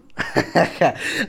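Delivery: short laugh. Real, not acted. Laughs at own impression.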